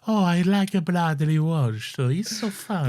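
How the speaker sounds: put-on grandma in law greeting Lorenzo, Italian accent; Italian nonna. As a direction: play a doting Italian granny calling his name. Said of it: "an impression of Lawrence's nonna-in-law".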